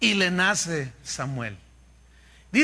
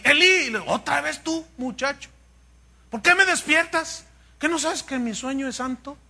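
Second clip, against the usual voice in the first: much higher, indignant grumbling; irritated old priest; 7 semitones higher than usual. Pretend. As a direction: tell the boy off for disturbing you. (Elí indignado, voz más aguda)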